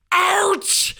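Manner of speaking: high, loud roar. A narrator's reading. Uncle roaring the boys out of the kitchen. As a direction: roar them out. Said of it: "roared Uncle Vernon".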